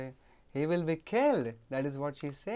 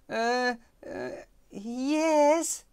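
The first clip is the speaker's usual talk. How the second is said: put-on hesitant boy; 6 semitones higher, 5 dB louder, much slower than usual.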